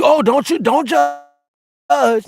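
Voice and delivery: very high, prissy mock plea; high squeaky mocking voice; mock prissy protest. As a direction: put on a high fussy voice to mock them. Mocking those who judge.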